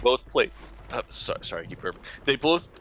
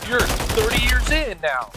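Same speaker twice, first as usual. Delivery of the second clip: higher, louder mock judge; stern judge voice; 9 semitones higher, 5 dB louder than usual. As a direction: bark out the sentence. Harsh sentence, mocking judge.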